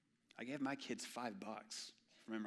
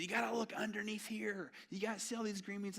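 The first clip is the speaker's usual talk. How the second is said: higher, lightly voiced grandma showing him; grandma instructing; 10 semitones higher, 4 dB louder than usual. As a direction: point it out like a patient grandmother. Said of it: "She said".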